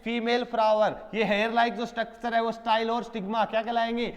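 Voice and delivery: ordinary delivery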